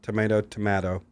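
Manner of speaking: "tomato" first as to-MAY-to, then to-MA-to; change the vowel for the second "tomato"; two contrasted pronunciations. Shown as a demonstration. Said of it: "No, I said tomato".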